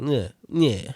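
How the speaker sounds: uncertain mock Russian; attempted Russian accent. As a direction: grunt agreement in a rough Russian accent. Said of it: "I don't know if that was Russian".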